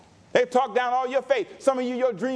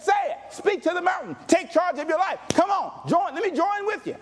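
preaching Jesus; 7 semitones higher than usual. Retold or imitated. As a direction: urge them to take charge, full voice. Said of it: "Jesus said".

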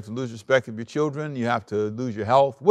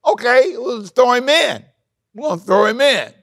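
mock careless people; 9 semitones higher, 5 dB louder than usual. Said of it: "They would say".

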